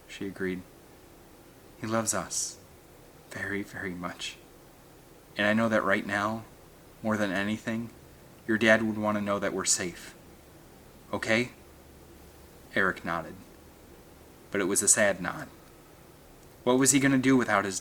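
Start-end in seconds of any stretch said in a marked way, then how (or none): 1.8-11.5 soft, tender mother's voice; calm the boy gently; tender reassurance. A narrator's reading. Mother softly reassuring son.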